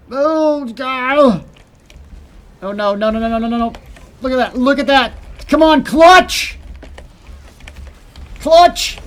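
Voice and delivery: high, loud panicked pleading; frantic shouting. Real, not acted. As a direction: scream it out, desperate. Boss nearly dead, panic.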